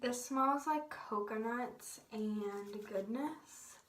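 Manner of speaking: ordinary talk, nothing marked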